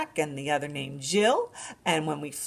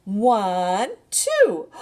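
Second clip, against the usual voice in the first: rising, louder count; excited counting; 4 semitones higher, 7 dB louder than usual. Shown as a demonstration. Librarian counting a duckling's feet excitedly. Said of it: "Can you count?".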